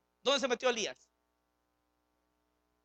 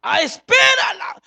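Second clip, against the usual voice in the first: fervent shout; 13 semitones higher, 15 dB louder, slower than usual. Acted. Preacher cries out.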